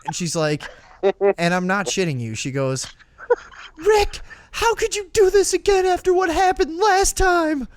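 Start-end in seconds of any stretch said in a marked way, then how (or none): 3.8-7.7 very high, loud imitation of a distraught mom; mock maternal screech; mother's outburst. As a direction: wail it like a hammed-up furious mother. Voices mother's outburst at her son.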